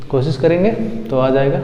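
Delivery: ordinary delivery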